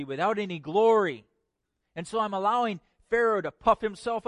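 impassioned preaching. Acted. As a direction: build God's case with emphatic force. Preaches in God's voice.

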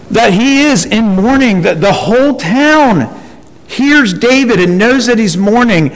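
normal talking voice

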